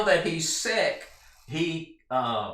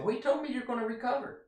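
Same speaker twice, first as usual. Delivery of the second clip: much higher, fast, sly and innocent; sly servant voice; 11 semitones higher, faster than usual. Acted. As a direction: play the servant slipping past the truth. Servant hides the death prophecy.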